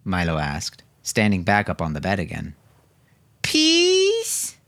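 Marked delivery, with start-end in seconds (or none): 3.4-4.6 put-on very high, loud small child's plea; a tiny girl begging to join in; pleading little girl. A narrator's reading. Rosa begging her parents for a treat, high pleading child. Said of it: "pleaded Rosa".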